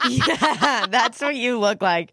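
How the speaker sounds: laughing while teasing; cracking up over a photo. Real, not acted. Laughing at the funny picture.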